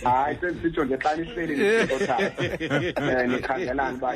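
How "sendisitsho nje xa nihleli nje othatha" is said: ordinary talk, nothing marked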